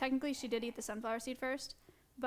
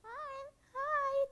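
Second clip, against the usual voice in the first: high squeaky pet voice, slow and sing-song; 12 semitones higher than usual. Real, not acted. Coos at the bird.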